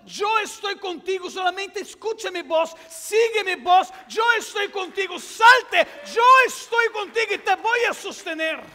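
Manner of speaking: preacher shouting God's words; God's voice proclaimed. Acted. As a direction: thunder out the divine promise. Voicing God's call, shouting.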